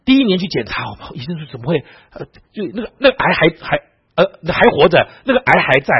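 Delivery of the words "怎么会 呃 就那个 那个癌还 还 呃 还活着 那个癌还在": high, stammering mock astonishment; hammed-up shock, halting and high-pitched; startled doctor. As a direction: imitate a doctor stumbling over the impossible. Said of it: "医生都吓坏了".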